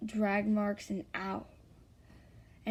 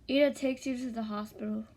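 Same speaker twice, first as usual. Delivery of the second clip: plain reading voice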